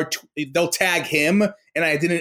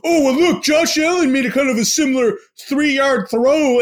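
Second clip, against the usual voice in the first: high, loud sarcastic parody of a fan; mocking fan voice; 8 semitones higher, 4 dB louder than usual. Podcaster mocking fans on Twitter, high, loud sneering parody. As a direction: ham up a smug fan's comeback, pitched high and loud.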